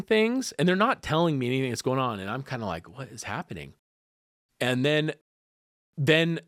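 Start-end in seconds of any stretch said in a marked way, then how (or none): 3.0-3.7 low, hushed bewilderment; quietly bewildered. Retold or imitated. Cousin left uninformed by the medical staff, quietly bewildered. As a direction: ask it under your breath, lightly baffled. Bewildered bystander in retold scene.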